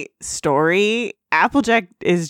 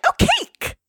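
very high, squealing cartoon pony delight; excited Pinkie Pie. Podcaster voicing Pinkie Pie, thrilled by cake, squealing high. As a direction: squeal it like a giddy pink pony spotting cake.

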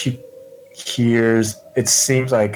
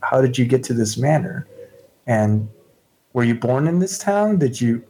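higher, halting, inquisitive character voice; gently curious newcomer, tentative; 4 semitones higher than usual. Acted. Shauna asking the townswoman her story, tentatively curious. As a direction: feel your way into the questions.

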